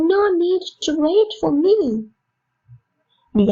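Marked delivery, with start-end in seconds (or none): 0.1-1.8 higher, slow, mildly irritated; mock annoyed repeat. Shown as a demonstration. Teacher showing an angry way to refuse waiting, mild. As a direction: say it with a touch of annoyance. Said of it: "கோவம் சொல்லுவீங்க".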